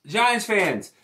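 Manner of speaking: usual speaking voice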